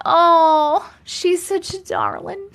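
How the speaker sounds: lightly voiced doting matron; Mama Tot voice. Cooing over chat like a doting older lady, lightly voiced. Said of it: "I sound like Mama Tot".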